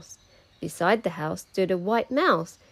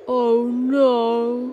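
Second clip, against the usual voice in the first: louder, higher wail of dismay; dismayed cry; 5 semitones higher, 7 dB louder than usual. A narrator's reading. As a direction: cry out in disappointment. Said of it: "cried George".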